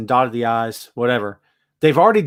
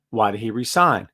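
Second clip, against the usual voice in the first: ordinary talk, nothing marked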